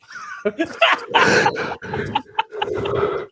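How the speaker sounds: wheezing high laugh; high breathless laugh. Real, not acted. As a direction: laugh until only air comes out. Laughing at own story.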